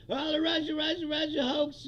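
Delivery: mocking imitation of a blustering politician; Donald Trump. Mimicking the president talking to Hannity, mock griping. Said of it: "Trump even said that yesterday".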